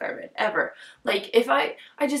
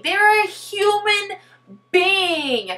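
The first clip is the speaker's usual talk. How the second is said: higher, slowed, emphatic; indignant; 8 semitones higher, 4 dB louder, much slower than usual. Real, not acted. Vlogger upset at the book's characters, indignant stress. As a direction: insist on their humanity. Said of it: "upset about the book".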